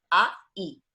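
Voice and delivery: "A-I" drawn out as two vowel parts, a gliding into i; the name "A-I" pulled apart as a diphthong; diphthong spelled out. Shown as a demonstration. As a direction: split "A-I" slowly into its two sounds. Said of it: "el nombre de la vocal es un diptongo".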